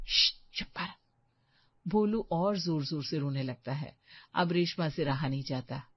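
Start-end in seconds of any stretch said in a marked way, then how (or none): 0.1-0.9 whispered shush; hushed whisper. Acted. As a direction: hush her so the guest won't hear. Hushing a friend near guests. (फुसफुसाकर चुप कराना)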